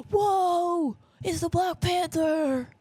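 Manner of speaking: high, drawn-out gasp of amazement; mock awe. Fan spotting Lab Rats actor as a kid, exaggerated awe. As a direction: stretch it out, wildly starstruck. Reenacts amazed movie moment.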